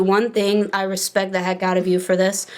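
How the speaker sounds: ordinary talk, nothing marked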